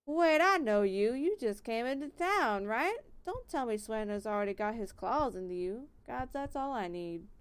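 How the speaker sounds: put-on country accent. Miller recognising the newcomer, country accent. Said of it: "That is very country".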